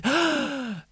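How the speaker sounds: sharp high gasp of surprise. Acted. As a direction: gasp in sudden amazement. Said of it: "the princess gasps".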